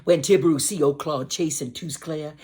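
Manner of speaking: usual speaking voice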